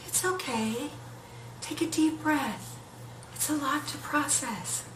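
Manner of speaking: calm her like a gentle breeze; soft breeze voice. Retold or imitated. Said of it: "more like a soft breeze".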